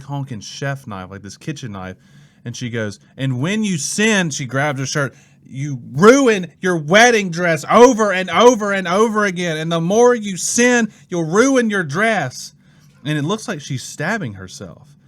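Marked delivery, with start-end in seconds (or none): 3.2-4.3 raised, slow mock warning; freaking out. Church lady warning teens about sin, mock freak-out. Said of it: "she's freaking out". 5.4-12.5 high, loud mock hysterical rant; freaking out. Church lady stabbing at her wedding dress, mock frenzy. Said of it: "she's freaking out".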